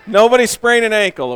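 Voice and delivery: laughing loudly over noise. Real, not acted. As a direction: call out while laughing. Laughing over jumping crowd.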